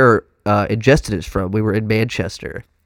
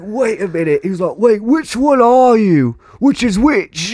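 high, drawn-out mock bewilderment; baffled; 10 semitones higher than usual. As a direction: imitate a thoroughly confused British bloke. Fooled British friend acted, baffled.

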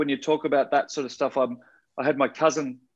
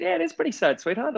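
put-on higher, gentle voice to a little girl; tender; 13 semitones higher than usual. Comforting young second cousin.

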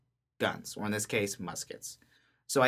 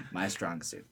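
ordinary talk, nothing marked

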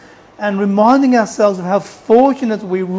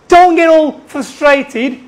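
ordinary delivery

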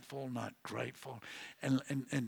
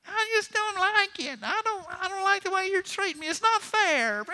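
put-on high-pitched, louder whiny child voice; whining grandchild; 5 dB louder than usual. Grandfather mocking his grandkids' whining in a high child voice. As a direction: mimic a kid whining that it's not fair. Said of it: "I hate it when they whine".

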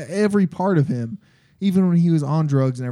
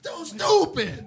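much higher, slow mock yell; slow high shout; 11 semitones higher, 3 dB quieter, much slower than usual. Quoting Farley line.

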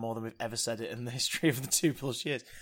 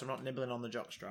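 normal talking voice

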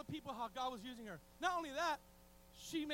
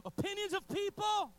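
loud, high preaching burst; sudden raised-voice exclamation; emphatic shout; 8 semitones higher, 8 dB louder than usual. Real, not acted. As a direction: shout it out to the congregation. Preacher bursts out after hushed list.

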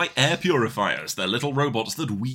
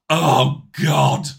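exasperated groan; 2 semitones higher, 9 dB louder than usual. Real, not acted. Exasperated outburst.

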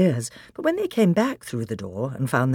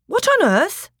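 high, loud burst of bewilderment; startled exclamation; 5 dB louder than usual. Acted. Peter breaking off as something pulls him, startled exclamation. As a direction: exclaim in sudden alarm.

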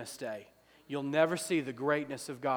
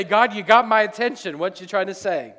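louder and higher, half-joking; mock exasperated shout; 4 semitones higher, 8 dB louder than usual. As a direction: call out to God in mock alarm. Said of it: "I would say".

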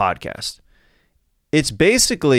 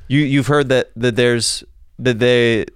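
ordinary talk, nothing marked

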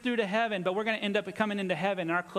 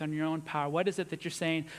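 ordinary talk

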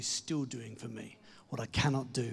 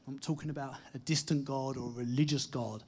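usual speaking voice

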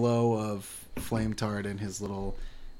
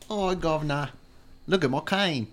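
high-pitched mock Cockney; Cockney accent; 6 semitones higher than usual. Friend joining in on the accent, mock Cockney. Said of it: "like, a Cockney accent".

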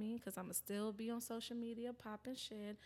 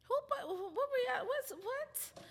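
high, loud squawk of disbelief; shrieky, half-laughing disbelief; incredulous outburst; 13 semitones higher, 5 dB louder than usual. Real, not acted. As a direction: stammer into a squealed challenge. Mock outrage at his plug.

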